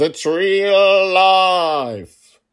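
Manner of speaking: high, very slow and loud, stretched to breaking; overblown, drawn-out cry; dramatic. Real, not acted. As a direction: belt it out like a grand proclamation. Repeating the claim more dramatically.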